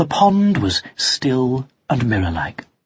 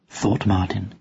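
ordinary delivery

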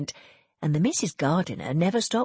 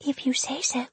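higher, breathless whisper; a faint, martyred whisper; 8 semitones higher than usual. Acted. Serena's weak reply.

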